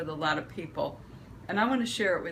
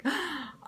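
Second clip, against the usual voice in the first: sharp gasp; 10 semitones higher than usual. Retold or imitated. Panic, husband missing in road.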